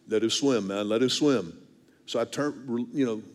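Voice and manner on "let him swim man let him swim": fishing guide. Retold or imitated. Guide urging the angler to let it swim, low imitated voice. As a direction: drop the pitch to play the guide. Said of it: "He goes".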